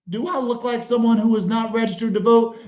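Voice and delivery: ordinary talk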